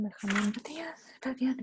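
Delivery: names read in a whisper. Real, not acted. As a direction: murmur the list to yourself. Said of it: "reading names under breath".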